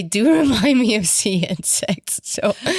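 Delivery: words broken by a laugh; giggling through the line. Real, not acted. Laughing at own prawn comparison.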